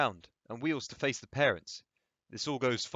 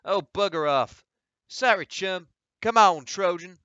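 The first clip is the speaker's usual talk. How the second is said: loud, higher scornful brush-off; dismissive brush-off; 8 semitones higher, 9 dB louder than usual. Acted. The rider tells Frank off and spurs his horse. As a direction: wave him away and ride off.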